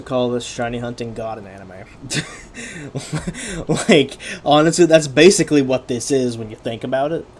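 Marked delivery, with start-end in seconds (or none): 1.9-3.8 short high burst of laughter. Real, not acted. Laughing at own joke. 3.9-7.3 loud, high, laughing excitement; excited. Real, not acted. Laughing at own joke.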